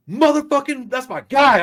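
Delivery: high, loud victorious hype; retold triumphant whoop; excited cheer. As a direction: cheer your buddy on like he just scored. Cheering friend who sided with him.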